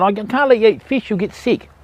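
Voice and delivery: usual speaking voice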